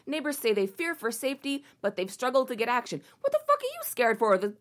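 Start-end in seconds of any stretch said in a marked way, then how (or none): 3.2-4.4 exasperated question. Real, not acted. Scoffing at neighbors' fear.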